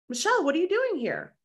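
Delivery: high, startled "what are you doing here"; surprised greeting. Retold or imitated. Colleague spots her at the event, surprised. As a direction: imitate a friend caught off guard.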